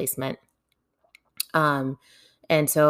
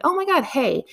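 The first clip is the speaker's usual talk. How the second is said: high-pitched, acted-out surprised hello; excited greeting; 12 semitones higher than usual. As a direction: greet a caller with a leap up in pitch. Pleasantly greeting social worker on phone.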